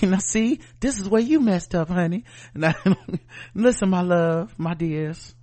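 a hint of a calm talk-show host scolding a guest; imitating Monique. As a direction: play Monique gently putting someone in their place. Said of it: "she just be talking to people so".

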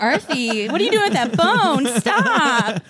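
high-pitched mock scold at the dog. Real, not acted.

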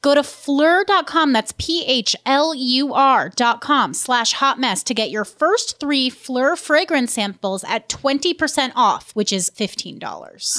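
slow upbeat ad read. Acted. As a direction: read the sponsor spot slowly. Podcast ad read.

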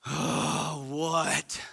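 put-on sighing, put-upon kid reply; annoyed kid. Kid on the couch answering a parent, put-upon sigh. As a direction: groan it like a bothered teenager. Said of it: "when we call out our kids".

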